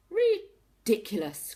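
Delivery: loud, slow scandalised cry; cried out. A narrator's reading. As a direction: boom it out in disbelief. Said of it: "cried Mister and Missus Tortoise together".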